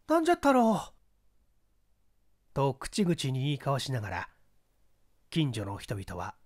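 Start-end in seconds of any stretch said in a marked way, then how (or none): 0.1-0.9 high, loud agitated repeat; excited clamour. Acted. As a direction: call it out, higher and louder. Neighbours asking each other. (騒然と興奮して)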